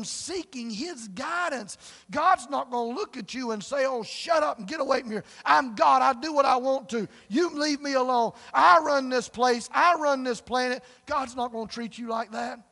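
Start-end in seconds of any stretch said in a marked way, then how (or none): 3.8-10.8 loud, higher, bellowing tyrant; harsh ruler. Pretend. Preacher voicing a God who would turn people away. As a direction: boom it like a bully king.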